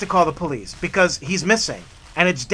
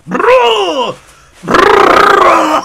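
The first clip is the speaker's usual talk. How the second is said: loud, high imitated retching; vomiting noise; 10 semitones higher, 10 dB louder than usual. Kids upstairs throwing up, imitated loud retching. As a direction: retch it out like puking. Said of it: "a puke-a-thon going on upstairs".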